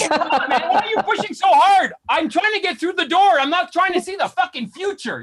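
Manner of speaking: high, straining mock complaint; exasperated protest. Man in revolving door to gym guy pushing, exasperated. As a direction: plead and scold, pitch pushed up. Acted complaint at hard pusher.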